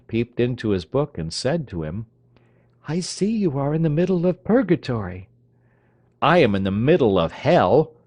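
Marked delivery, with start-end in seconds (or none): 2.9-5.3 high, playful teasing voice; teasing young lady. Acted. Marionetta teasing Scythrop. 6.2-7.9 loud, furious retort. Acted. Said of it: "said Scythrop furiously".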